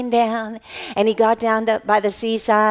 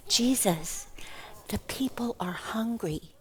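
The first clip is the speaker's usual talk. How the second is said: very soft, hushed murmur; secretive whispered report; 13 dB quieter than usual. Acted. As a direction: pass it on discreetly under your breath. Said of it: "just whisper".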